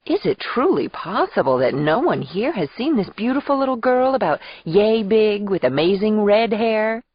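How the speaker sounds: high, swooping soap-opera wonder; theatrical maternal call. Acted. Aunt pretending to search for her niece, theatrical and sweet. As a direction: ham up the search for a little girl. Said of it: "exaggerated gestures and body language of a soap opera".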